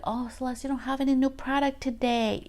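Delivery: high, lifted mock surprise at the empty shelf; playful client noticing a gap; imitating a surprised client.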